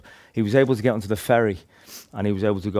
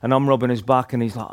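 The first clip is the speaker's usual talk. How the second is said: normal talking voice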